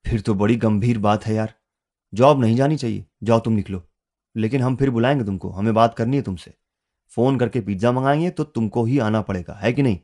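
ordinary delivery

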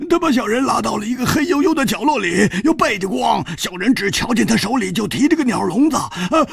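high, whimpering stammer; whining. Acted. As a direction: plead with a pained face. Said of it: "苦着脸说".

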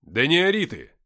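loud, sharp rebuke; barked order. A narrator's reading. Narrator grabbing screaming Nikita, barked order. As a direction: bark it to silence a screamer. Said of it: "гаркнул я".

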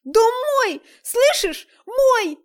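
high, loud hysterical scream. Acted. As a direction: break into a full scream. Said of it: "Настя окончательно сорвалась на крик".

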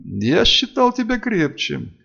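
high, slow, mildly sharp reproach; stern disappointment, not shouted; sharp rebuke. A narrator's reading. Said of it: "Резко бросил Хедин".

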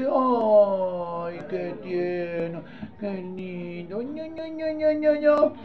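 soft, drawn-out doting coo; exaggerated fawning over a tiny infant; mock cooing over baby. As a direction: gush softly, rising into baby talk. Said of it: "usted los ve así tiernitos".

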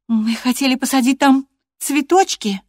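put-on high, loud young woman's outcry; defiant cry. A narrator's reading. Catherine breaks in on the master about her flowers, crying out. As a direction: cry it out, pitched well up. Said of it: "крикнула она".

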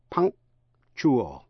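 "punctual" split in two, the k held silent before "chual"; slow demo of "punctual" with an unreleased k. Shown as a demonstration. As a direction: stop on "punk" without releasing the k, then "chual". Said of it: "không phát âm k thành tiếng, rồi tiếp ngay sang chual".

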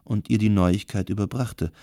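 ordinary delivery

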